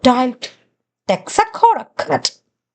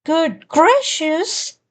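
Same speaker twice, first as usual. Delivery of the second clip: gasped; 4 semitones higher, slower than usual. A narrator's reading. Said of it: "gasped my sister".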